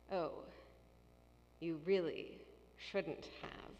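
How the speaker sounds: low, soft, reproachful; disapproving. Shown as a demonstration. Warning a friend never to do it again, low and reproachful. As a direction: let the thanks sound like a rebuke. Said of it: "that means you should not have done that thing".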